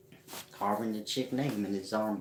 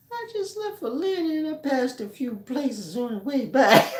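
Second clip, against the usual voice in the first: laughing; 6 dB louder than usual. Real, not acted. Giggling while teasing the caller.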